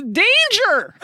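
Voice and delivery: sudden high yelp of a warning; mock alarm cry. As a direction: cry out as if to warn the heroine of danger. Warning the character in jest.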